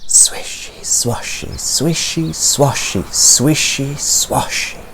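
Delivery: soft breathy whisper, rhythmic; whispered swishy swashy. A narrator's reading. Reading the bear hunt to children, whispered sound effect. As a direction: hush it like grass brushing by.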